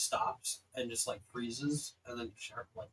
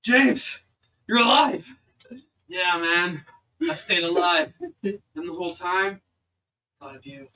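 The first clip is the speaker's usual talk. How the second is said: loud, high gushing buddy voice; loud, hammy best-friend gushing; over-the-top reunion; 4 semitones higher, 9 dB louder than usual. Acted. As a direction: ham up the tearful bro love. Player acts friend's emotional reunion.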